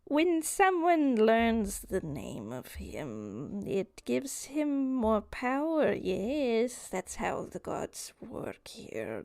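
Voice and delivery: slow creature voice with long swooping words; Nanoxus confessing. Acted. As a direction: confess slowly, drawing out each "yes". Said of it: "I was scared".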